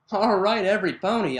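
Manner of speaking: ordinary delivery, nothing marked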